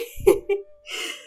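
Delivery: high, airy giggle; giddy laugh. Real, not acted. Gamer laughing with joy over her pull, giddy laugh. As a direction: giggle helplessly.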